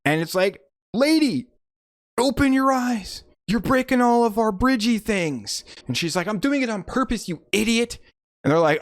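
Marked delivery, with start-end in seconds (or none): 0.9-5.6 high-pitched exasperated heckle; exasperated scolding. Retold or imitated. Team scolding Sylvia Surfer for breaking their things, exasperated. Acting out movie characters scolding. 6.3-8.0 snippy retort. Retold or imitated. Sylvia retorts to the team.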